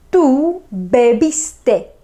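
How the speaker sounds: slow, careful "Tú bebiste"; slow it right down for the class; slow model sentence. Shown as a demonstration. Teacher modelling beber for students, slow.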